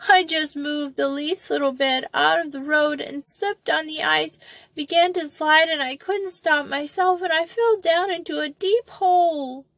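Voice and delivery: put-on a lost child answering through tears; sobbing little girl. A narrator's reading. Molly answers Betsy from the pit, sobbing.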